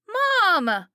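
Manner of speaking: put-on high, loud girl's cry of alarm; a scared daughter yelling at her mom; Kelly crying out. A narrator's reading. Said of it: "Kelly cried".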